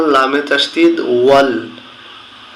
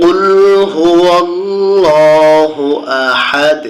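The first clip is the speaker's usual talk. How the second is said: slow, drawn-out melodic tilawah; Quran recitation; 3 semitones higher, much slower than usual. Shown as a demonstration. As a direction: stretch হুয়াল্লাহু in a long chant. Teacher recites the verse.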